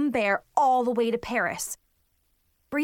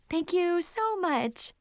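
forced politeness; 7 semitones higher, slower than usual. Acted. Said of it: "I mustered a smile".

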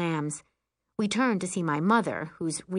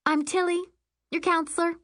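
high, perky introduction; friendly camp counselor; 12 semitones higher than usual. Acted. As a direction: introduce yourself sweetly to a child. Counselor introduces herself to child.

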